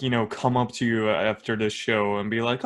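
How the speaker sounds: ordinary talk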